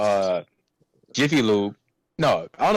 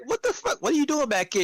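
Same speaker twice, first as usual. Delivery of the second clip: very high-pitched disbelieving question; mock incredulous. Worker finding scared coworker followed him to the back, high incredulous yelp. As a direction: squeak it out in exaggerated bafflement. Retelling startled reaction to follower.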